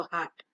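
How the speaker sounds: हार्ट said as "haat", no rounding; long aa vowel. Shown as a demonstration. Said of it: "इस हार्ट में नहीं लगा".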